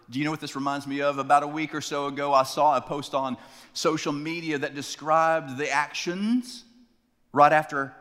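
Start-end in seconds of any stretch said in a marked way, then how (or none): none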